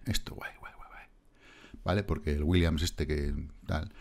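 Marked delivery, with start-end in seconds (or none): 0.1-1.0 mock whisper. Mocking the project as cool.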